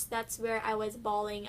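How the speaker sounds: ordinary talk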